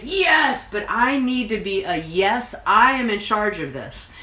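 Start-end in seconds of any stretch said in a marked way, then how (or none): 0.0-0.7 a high, loud, gushing yes; play-acted over-the-top yes; enthusiastic yes. Shown as a demonstration. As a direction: burst out with a mock-eager yes. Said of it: "I don't have to get all enthusiastic".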